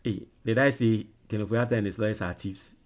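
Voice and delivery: ordinary talk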